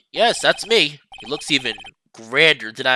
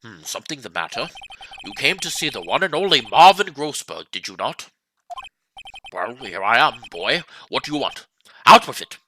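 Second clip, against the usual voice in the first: lower, self-important senior lawyer; pompous old lawyer; 3 semitones lower than usual. Acted. Old lawyer sizing up the young visitor, lower and pompous. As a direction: look down on the young man.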